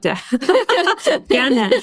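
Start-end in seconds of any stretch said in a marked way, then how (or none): 0.3-1.7 a bright laugh breaking through the word. Real, not acted. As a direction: say it while laughing. Host laughs while thanking guest.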